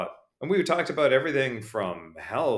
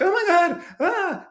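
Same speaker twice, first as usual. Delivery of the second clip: high, loud mock panic over a scraped knee; panicked parent; 6 dB louder, slower than usual. Parent panicking at child's scraped knee, mock alarm. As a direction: shriek like a frightened parent.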